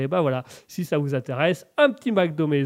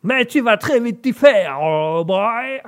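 loud, high, slow mock American; hammed-up American accent in French; McDonus; 6 semitones higher, 7 dB louder, slower than usual. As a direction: holler it like a stage American. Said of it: "pour le côté américain".

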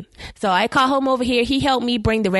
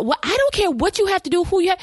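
pleading ex; 9 semitones higher than usual. Retold or imitated. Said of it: "he was like".

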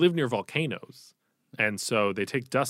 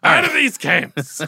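much higher, loud, slow yell; loud gung-ho soldier; 11 dB louder, slower than usual. Retold or imitated. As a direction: finish the rallying cry at the top of the voice. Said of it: "He's very Al Pacino in it".

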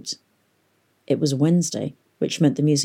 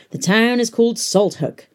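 booming announcer; 6 semitones higher than usual. A narrator's reading. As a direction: boom it out like a public announcer. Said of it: "boomed the voice of the announcer".

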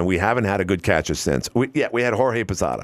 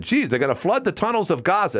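retold alarmed caller; 7 semitones higher than usual. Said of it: "the one guy said".

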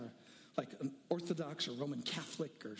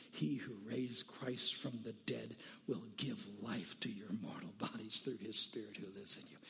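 hushed whisper; 8 semitones lower, 5 dB quieter than usual. Real, not acted. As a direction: whisper the verse like a sacred secret. Reads Romans, hushed.

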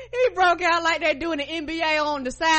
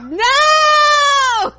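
a high, loud shouted no; mock yell; 11 semitones higher, 9 dB louder than usual. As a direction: shut him down with a yell. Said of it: "And she was like".

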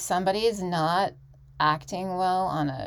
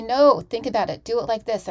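firm coach's no, a bit louder; a coach cutting in, lightly voiced; imitating coach's correction; 3 semitones higher, 7 dB louder than usual. Coach telling her student to redo it, firm and loud. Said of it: "she'll say".

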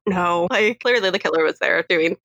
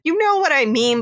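higher insistent whine; exasperated Sydney; 9 semitones higher than usual. Retold or imitated. Host voices Sydney insisting to the detective. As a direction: push it, frustrated. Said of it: "she's like".

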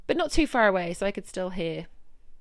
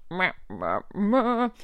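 kiss noises; 4 dB louder, much slower than usual. Real, not acted. Kiss sounds at work arrival.

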